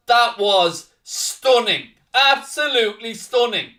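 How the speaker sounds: real elation, gradually coming down from a scream; thrilled goal call. Real, not acted. Describing the wonder goal just scored.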